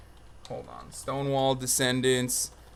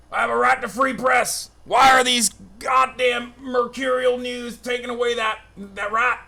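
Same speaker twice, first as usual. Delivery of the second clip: mock outrage, higher and louder; hammed-up indignation; 9 semitones higher, 4 dB louder than usual. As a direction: play the wronged citizen, over the top. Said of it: "mock outrage about free press".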